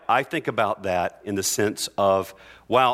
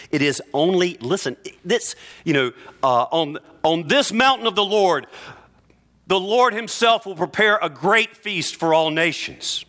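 higher, louder impassioned preaching; preaching at full force; 9 semitones higher, 4 dB louder than usual. Acted. Pastor proclaiming the great feast to the congregation, impassioned preaching. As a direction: lift the room and soar on the promise of the feast.

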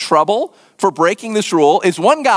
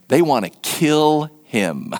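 ordinary talk, nothing marked

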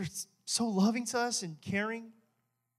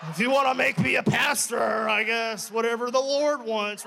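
eager yes; 4 semitones higher, 4 dB louder than usual. Retold or imitated. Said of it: "I'm like".

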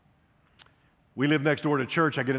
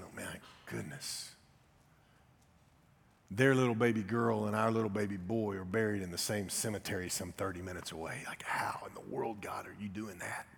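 awed whisper; 3 semitones lower, 8 dB quieter than usual. Retold or imitated. Pastor realizing both babies lie in one cemetery, whispering. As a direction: whisper it in disbelief.

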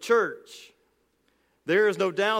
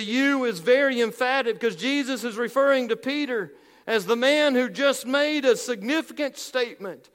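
raised, driving sermon voice; fervent preaching; 4 semitones higher, 4 dB louder than usual. Acted. Preacher rousing his congregation, fervent preaching. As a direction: push the point home like a pulpit preacher. Preacher building to full force.

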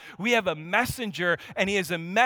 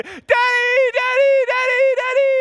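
put-on toddler screaming; 10 dB louder than usual. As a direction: scream it like an excited two-year-old. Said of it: "He's screaming".

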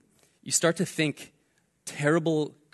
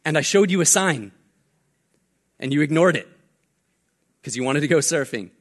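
told-you-so; 5 dB louder than usual. Retold or imitated. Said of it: "And he's like".

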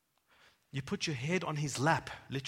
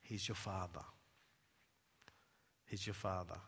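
softly and much lower, close and gentle; tender hush; 11 semitones lower, 12 dB quieter than usual. Acted. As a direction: speak it like a father whispering to a child. Intimate prayer instruction.

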